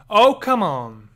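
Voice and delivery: loud, exasperated shout; real annoyance, shouted out; frustrated outburst. Real, not acted. Annoyed at a missing achievement.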